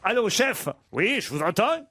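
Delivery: put-on botched Spanish accent. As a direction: fake a Spanish accent, badly, with a German ring. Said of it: "Tu peux faire l'accent espagnol et pas l'accent allemand".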